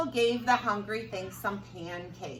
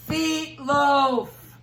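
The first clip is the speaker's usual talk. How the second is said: monster voice; 6 semitones higher, 10 dB louder than usual. Acted. Hungry thing answering the townspeople, loud creature voice. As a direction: boom the word like a hungry beast.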